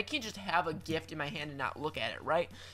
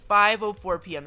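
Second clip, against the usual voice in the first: "Five oh four P M" said much louder, each number hit hard; exasperated repeat; 3 semitones higher, 11 dB louder than usual. Real, not acted. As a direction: belt out the time in disbelief. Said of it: "repeating the time louder".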